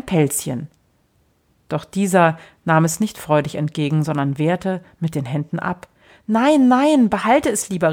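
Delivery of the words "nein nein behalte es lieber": calling out. Acted. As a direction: call out a quick refusal. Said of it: "rief der Kleine".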